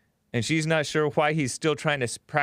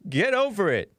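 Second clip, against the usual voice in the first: mock dismissal; 6 semitones higher than usual. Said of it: "Jesse Lee Peterson is like".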